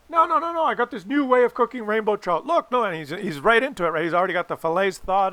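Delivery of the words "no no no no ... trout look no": much higher, rapid no no no brushing objections aside; dad too thrilled to listen; excited dad insisting. Retold or imitated. Dad insists on new recipe.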